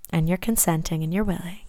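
ordinary talk

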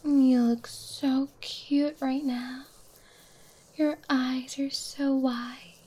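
usual speaking voice

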